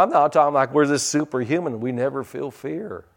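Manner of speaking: ordinary talk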